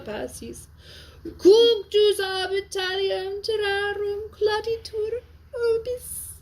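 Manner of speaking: high, near-sung Latin lament, voice full of tears; tearful plea. Acted. Teacher voices Venus begging Jupiter, tearful and high. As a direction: read it as Venus weeping to her father. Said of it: "You hear the tears?".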